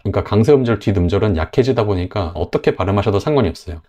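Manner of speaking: normal talking voice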